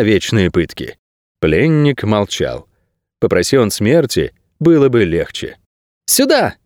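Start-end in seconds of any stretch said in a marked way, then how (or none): 6.1-6.5 high, ringing call to come over; boy's high-pitched cry; shout. Acted. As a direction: call out in a much higher voice. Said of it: "крикнул Леви".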